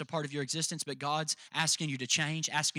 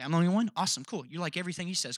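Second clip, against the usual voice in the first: ordinary delivery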